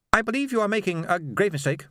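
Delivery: a calm fanatic lecturing; Swing's cold voice. A narrator's reading. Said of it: "said Swing".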